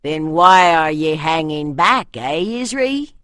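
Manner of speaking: a hint of a northern warrior's accent, taunting. A narrator's reading. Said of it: "This in the accent of the north".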